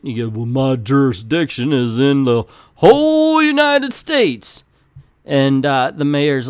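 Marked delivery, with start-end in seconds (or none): 0.4-4.6 hammed-up hero proclamation, climbing at the end; mock pompous boast. Hero answering the mayor about jurisdiction, mock pompous boast. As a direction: stretch out the big claim grandly.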